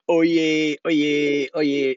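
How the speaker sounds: a hint of formal court crier; lightly voiced court crier. Mocking how Supreme Court trials open, mild crier's call. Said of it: "trials in the Supreme Court with".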